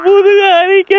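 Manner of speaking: high put-on baby wail; baby crying. Baby with an itchy back, mock crying. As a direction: bawl the words like a baby. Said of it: "சரி, கத்துவோம்".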